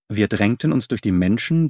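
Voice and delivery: ordinary delivery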